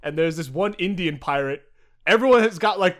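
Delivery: usual speaking voice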